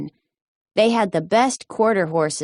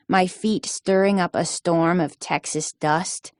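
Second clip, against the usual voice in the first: usual speaking voice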